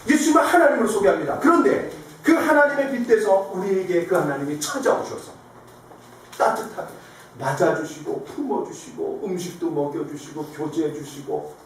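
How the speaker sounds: normal talking voice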